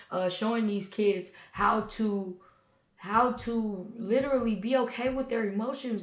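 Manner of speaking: ordinary talk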